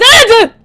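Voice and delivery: sudden high loud scream; terrified scream. Real, not acted. Player screams when the monster attacks, terrified. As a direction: shriek as you're grabbed.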